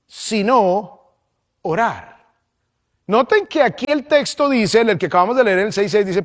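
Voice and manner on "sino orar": deliberate pause between sino and orar; pause before orar. Shown as a demonstration. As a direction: let sino hang before dropping orar. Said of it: "Lo repito".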